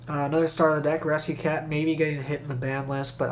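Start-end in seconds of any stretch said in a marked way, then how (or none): none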